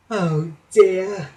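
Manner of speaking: mild groan of disappointment. A narrator's reading. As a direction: groan it with a sinking heart. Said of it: "groaned Percy".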